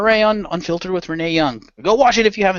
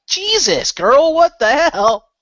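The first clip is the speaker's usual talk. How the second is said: higher, slow, hammed-up disbelief; mock disbelief; 9 semitones higher, 4 dB louder, much slower than usual. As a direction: stretch out a shocked "what the hell". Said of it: "I'm like".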